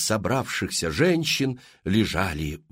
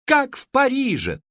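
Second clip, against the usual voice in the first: high loud chant; crowd cheering; 13 semitones higher, 4 dB louder than usual. Acted. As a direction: cheer as one. Толпа кричит хором. (дружный высокий выкрик толпы)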